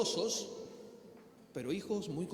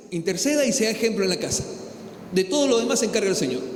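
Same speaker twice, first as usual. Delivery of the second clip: loud, driving sermon to the congregation; full-force preaching; 11 dB louder than usual. Acted.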